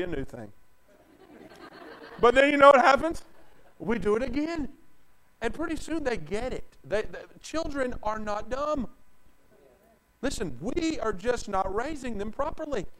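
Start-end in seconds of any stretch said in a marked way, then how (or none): none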